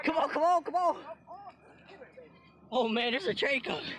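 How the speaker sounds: urgent high calls; being chased. Real, not acted. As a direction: call out in a panic. Fleeing from a train.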